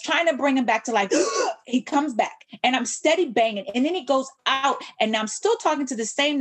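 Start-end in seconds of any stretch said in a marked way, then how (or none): 1.1-1.6 high, sudden re-enacted intake of breath; reviving gasp. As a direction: gasp like someone jolted back to life. Imitates son coming back to life.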